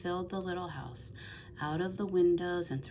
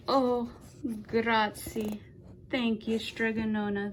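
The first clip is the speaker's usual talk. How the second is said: higher, louder grateful cry; cried out; 6 semitones higher, 4 dB louder than usual. A narrator's reading. The people thank Strigonona, higher, louder relieved cry. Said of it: "the people cried".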